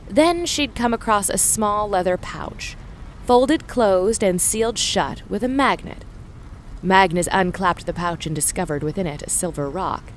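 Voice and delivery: ordinary delivery, nothing marked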